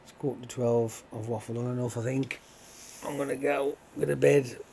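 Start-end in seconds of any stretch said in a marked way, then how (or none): 3.1-4.6 yawning through the words; sleepy, mid-yawn speech. Real, not acted. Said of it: "'cause I'm yawning my head off".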